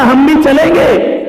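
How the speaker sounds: put-on high, slow, loud little-child whine; child pleading. Small child asking his mother to take him to the party. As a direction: beg to come along like a small boy.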